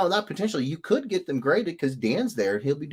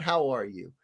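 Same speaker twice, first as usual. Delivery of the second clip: normal talking voice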